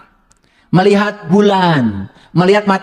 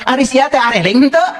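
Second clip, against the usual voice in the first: sun's voice; 6 semitones higher than usual. Acted. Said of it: "panon poe teh ... hayang ngomong".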